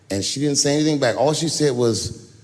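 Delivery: ordinary delivery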